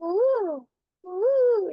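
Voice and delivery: high, drawn-out cuckoo hoot; imitated koel song; cuckoo call. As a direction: call "ooh" like a koel, pitch swooping up. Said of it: "What does the koel say?".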